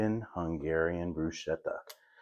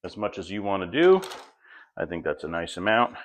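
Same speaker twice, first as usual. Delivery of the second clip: ordinary talk, nothing marked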